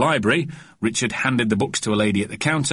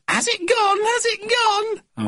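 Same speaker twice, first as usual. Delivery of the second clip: very high, squeaky, anxious question; panicked woman; slower than usual. A narrator's reading. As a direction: squeak it fearfully, drawing out the end. Terrified rigid woman asks.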